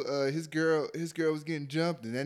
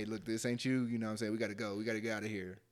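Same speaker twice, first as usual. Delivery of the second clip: softer, calm, steady coaxing; calm reassuring girlfriend; 4 semitones lower, 8 dB quieter, faster than usual. Retold or imitated. Retelling a calm girlfriend pulling her man away, softer reassuring voice. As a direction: gently talk him out of the fight. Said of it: "handled the situation like".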